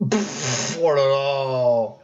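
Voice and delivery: slow drawn-out scoffing sigh; scornful sigh. Real, not acted. Reacts to the bugging stream.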